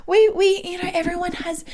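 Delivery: calm a nervous patient like the physio; the physiotherapist reassuring her patient, high and gentle; soothing physio. Retold or imitated. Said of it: "She's like".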